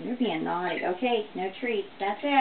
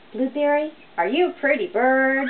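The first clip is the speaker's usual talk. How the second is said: excited pet talk; 3 semitones higher, 8 dB louder than usual. Real, not acted. Owner coaxing her parrot.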